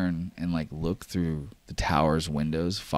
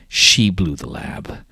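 slow, louder grave statement; grave accusation; 7 dB louder, slower than usual. Acted. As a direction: lean hard on SHE, slowly, then fall. Villain reports the lab's destruction.

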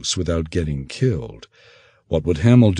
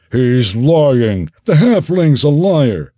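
much higher, louder angry crew yell; shouting; 7 semitones higher, 6 dB louder, slower than usual. Acted. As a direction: shout them down. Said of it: "several of Skamang's supporters shouted".

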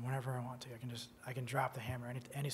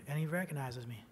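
ordinary talk

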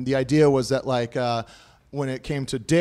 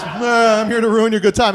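lightly voiced drunken walrus, higher pitch; 5 semitones higher than usual. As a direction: groan it like a party-ruining walrus. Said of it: "like a drunk, shitty walrus".

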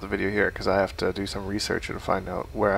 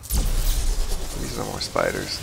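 creepy low voice; much slower than usual. Pretend. More spiders appear in game.

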